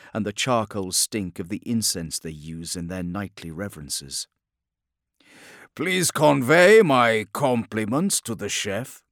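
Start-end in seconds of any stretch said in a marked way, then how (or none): 5.8-9.0 louder, sarcastic politeness; mock courtesy. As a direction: play the gracious guest mockingly. Sarcastic to captors.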